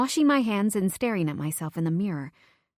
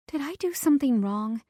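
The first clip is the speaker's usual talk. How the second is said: usual speaking voice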